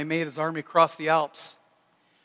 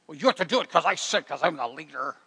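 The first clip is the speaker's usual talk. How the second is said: faster, bossy mock boss voice; domineering; 3 semitones lower than usual. Domineering leader telling the family what to do, mock bossy. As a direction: imitate a self-appointed boss laying down orders. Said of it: "not domineering".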